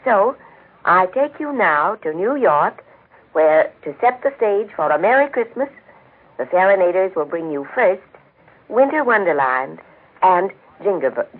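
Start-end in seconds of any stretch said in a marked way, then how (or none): none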